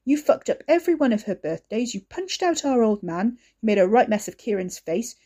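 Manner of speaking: furious tirade. Acted. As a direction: brother tearing into Ricky. Wes berates Ricky.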